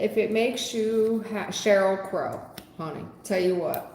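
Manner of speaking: normal talking voice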